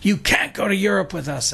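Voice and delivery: imitating Jerry snapping at him; retold yell. Jerry refusing the pushy gardener, in a high mock yell. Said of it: "he yelled at me and he said".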